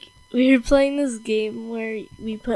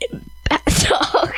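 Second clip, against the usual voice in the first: whisper. Pretend. Silly character claim.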